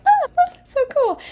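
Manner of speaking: high, slow, laughing squeal of delight. Retold or imitated. Imagining finding a penny, a high laughing squeal. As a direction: gush over it with a giggle, way up high.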